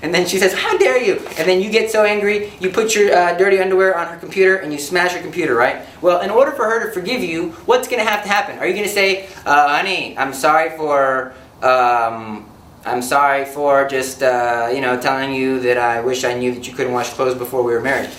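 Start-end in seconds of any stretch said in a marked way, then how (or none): 0.5-1.2 higher, offended gasp of a line; mock outraged wife. Wife to husband after his insult, mock outrage. 9.5-18.1 lower, slow and hesitant, mumbling excuses; half-hearted apology. Acted. Husband to wife after smashing her computer, feeble apology.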